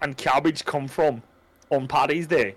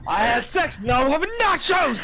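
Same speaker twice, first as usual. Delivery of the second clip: mocking echo; 7 semitones higher than usual. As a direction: throw her words back, shouting with glee. Mocking cohost's line, laughing.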